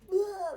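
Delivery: a high, loud full-throated demo scream. Shown as a demonstration. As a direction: let it rip like people in the woods. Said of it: "like, really loud".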